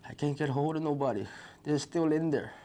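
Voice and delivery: mild retold distress, voice lifted up; lightly voiced crying. Retelling the crying lady on her phone, mild tearful distress. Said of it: "crying on her phone".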